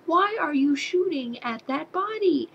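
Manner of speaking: ordinary delivery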